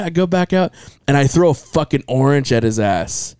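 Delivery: ordinary talk, nothing marked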